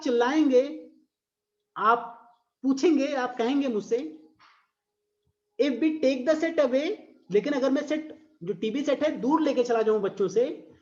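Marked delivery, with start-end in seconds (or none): 5.6-7.0 slow, sing-song poem reading; drawn-out recital voice; poem recitation. Shown as a demonstration. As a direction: read it out like a verse for class.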